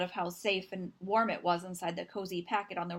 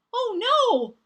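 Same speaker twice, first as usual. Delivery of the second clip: high, loud panicked cry; frightened cry; 13 semitones higher, 10 dB louder than usual. Acted. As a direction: cry out like a fox caught in a storm. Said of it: "Little Fox cried".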